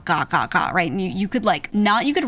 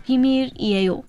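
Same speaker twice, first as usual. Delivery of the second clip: slow, careful 비밀이에요, pitched up; 5 semitones higher, much faster than usual. Shown as a demonstration. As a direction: draw out 비밀이에요 syllable by syllable. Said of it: "is a slow way to do it".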